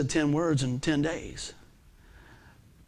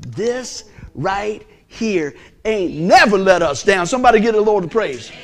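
slow, loud drawn-out preaching; preaching climax; 3 semitones higher, 7 dB louder, slower than usual. Acted. Preacher calling the church to praise, slow and loud. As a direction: stretch "never" and call for praise.

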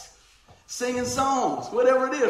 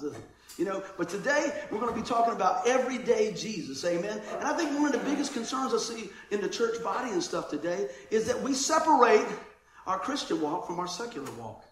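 ordinary delivery

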